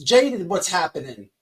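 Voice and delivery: usual speaking voice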